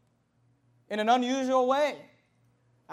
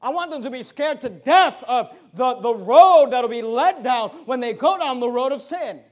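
preaching; 2 semitones higher, 9 dB louder than usual. Acted. Preaching at full force.

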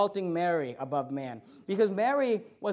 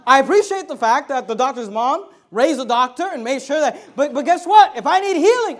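loud, high-pitched preaching, building hard; full-force preaching; 5 semitones higher, 10 dB louder than usual. Real, not acted. Preacher arguing the doctor's mom example, loud full-force preaching. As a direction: drive it home at the top of your preaching voice.

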